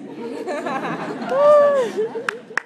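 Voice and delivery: high, loud laugh; loud laughter. Real, not acted. Laughing after doing the line for fans, loud. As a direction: laugh it off loudly.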